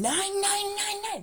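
high, slow furious mock rant; Downfall Hitler rant. Imitating the Downfall rant, high, slow and furious. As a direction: rage like Hitler in the bunker. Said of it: "That's how angry he was".

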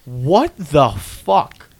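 high, loud disbelieving exclamation, last word slow; real shock at what he saw. Real, not acted. Reacting to last night's South Park episode with real shock.

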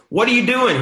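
high, loud incredulous shout; calling out. Retold or imitated. Climber calls ahead to son out of earshot, high shout. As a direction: yell it up the trail to someone far off.

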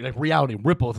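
ordinary talk, nothing marked

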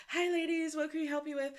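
lightly voiced high-pitched greeting; shop assistants. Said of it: "the ladies are like".

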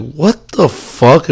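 slow, drawn-out, high disbelief; stunned disbelief. Retold or imitated. Drunk guy seeing his cousin stroll in with pizza, slow disbelief. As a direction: drag it out, not believing your eyes. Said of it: "I'm like".

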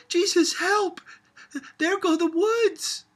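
high, loud cry of dread and rapture; frightened cry. A narrator's reading. Sophia crying out as the bulldozer tears down the woods. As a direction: cry out as the trees fall.

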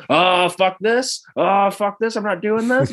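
high-pitched panicked bail-out; mock panic. Retelling a kamikaze pilot backing out, mock panic.

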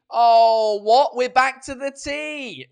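loud, surprised, hammed-up outburst; mock surprise. Host fakes loud shock that today's drink is tea. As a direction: fake shock at the cup of tea.

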